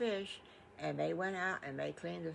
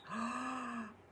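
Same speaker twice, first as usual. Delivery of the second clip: sharp, high delighted gasp; 6 semitones higher, 3 dB quieter than usual. Acted. Delight at sweet potato fries.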